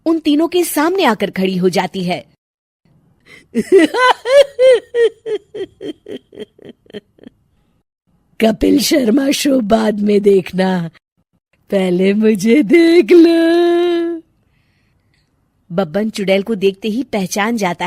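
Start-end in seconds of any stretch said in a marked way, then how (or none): 3.5-6.4 very high, loud evil cackle; witch cackle. Acted. Witch cackles as she lands in front of the three villagers. 8.4-14.2 loud, slow teasing witch; mocking witch. Witch taunts the villagers watching the Kapil Sharma show.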